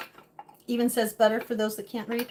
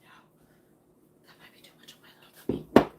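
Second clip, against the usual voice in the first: fast little whisper; quick whispered aside; 4 dB quieter than usual. Real, not acted. Aside about her tummy.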